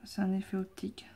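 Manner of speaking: whispered to herself; breathy whispered aside; whispering. Real, not acted. Mutters to self, then repeats.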